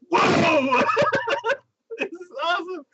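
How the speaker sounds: 높은 목소리로 와! 하고 감탄; 웃음 섞인 흥분된 감탄; amazed. Real, not acted. Amazed at real pitching.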